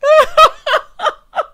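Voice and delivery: high, loud cackle; helpless high-pitched cackling; laughing. Real, not acted. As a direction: burst out in a big, high laugh. Cracks up at retold quote.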